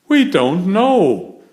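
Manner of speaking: loud exasperated groan. Acted. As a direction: groan it out, fed up. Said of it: "Grumpy Toad groans".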